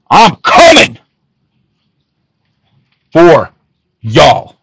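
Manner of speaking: loud threat. Real, not acted. Warning the influencer's supporters loudly. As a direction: throw it out like a battle cry.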